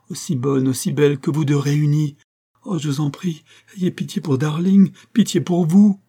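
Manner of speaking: high plea building to a loud cry; desperate imploring. A narrator's reading. Infected woman pleading for their child, imploring cry. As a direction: beseech with mounting urgency.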